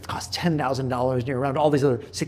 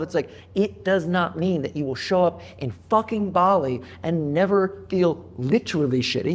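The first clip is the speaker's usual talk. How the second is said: usual speaking voice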